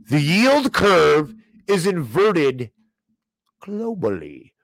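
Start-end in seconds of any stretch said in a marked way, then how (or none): 0.1-2.7 drawn-out, louder, every word weighed; slow emphatic lecture. Acted. Host slowly lectures Elon Musk on inverted yield curve. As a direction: spell it out slowly like a warning.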